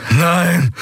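high, falling cry of denial; horrified cry. Acted. Prisoner collapsing to the floor, horrified cry. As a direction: cry out against the realisation.

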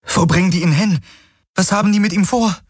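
high, urgent questions, quickening; alarmed questioning. A narrator's reading. Kirai asks Hocks about the abducted man, urgent and high. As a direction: demand answers in rising alarm.

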